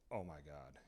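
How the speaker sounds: very low, barely voiced disbelief; hushed shock. Man on the phone spotting something, hushed and stunned. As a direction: let it drop to a stunned murmur.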